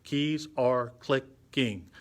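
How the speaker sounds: slow "Keys are clicking", a separate beat for each syllable. Shown as a demonstration. Teacher likens syllables to music beats, beat by beat. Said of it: "a syllable is kinda like a beat".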